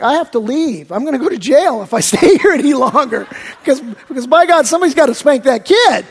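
half-laughing exasperation building to a boil; acted fed-up outburst. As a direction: act like you're about to lose patience. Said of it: "you get to the point where it's like".

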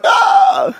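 high, loud "Ah!"; mock startled shriek. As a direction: imitate a startled yelp. Retelling a woman's startled reaction.

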